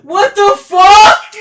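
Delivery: high, loud stunned yell with rising end; real hyped shout over rare loot; excited shout. Real, not acted. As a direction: scream it in pure disbelief. Finds rare loot, yelling.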